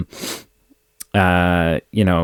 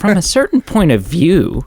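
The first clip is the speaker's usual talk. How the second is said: higher, mocking disbelief; incredulous Luke; 8 semitones higher than usual. Luke mocked as disbelieving Obi-Wan's excuse, higher incredulous echo. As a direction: repeat the phrase back in outrage. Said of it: "And Luke's like".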